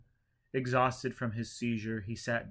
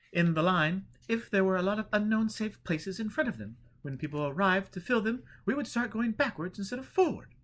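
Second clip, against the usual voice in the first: high, raised voice of a woman refusing politely; woman in line; 10 semitones higher, 6 dB louder than usual. A narrator's reading.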